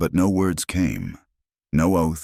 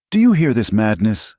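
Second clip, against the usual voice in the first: louder, high-pitched sharp demand; sharp outburst; 6 semitones higher, 6 dB louder than usual. A narrator's reading. Nafret appeals to the court for loyalty, voice sharp. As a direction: cry out sharply in indignation. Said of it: "voice sharp".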